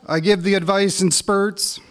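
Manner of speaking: spoken-word poetry reading; poem reading. Acted. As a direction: read it like a performed poem, building with the story. Reads his hockey poem.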